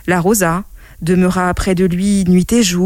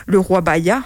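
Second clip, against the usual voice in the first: plain reading voice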